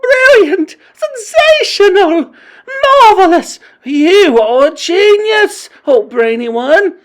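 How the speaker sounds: high, loud, frenzied witch cheers; wild cheering shout. A narrator's reading. Witches cheering the Grand High Witch, loud ecstatic shouting. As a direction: roar your praise like a crazed fan. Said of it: "the audience was clapping madly and shouting".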